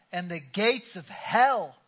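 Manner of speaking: rising preacher's declaration; building proclamation. Real, not acted.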